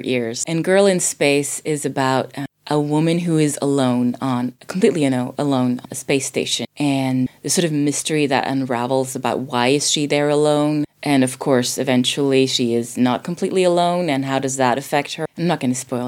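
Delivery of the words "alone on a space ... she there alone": ordinary talk, nothing marked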